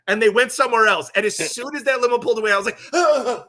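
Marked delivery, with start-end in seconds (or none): 2.9-3.4 loud, high gasps of released tension; acted gasping relief. Door guy once the limo pulled away, gasping. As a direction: gasp as if finally breathing after a standoff.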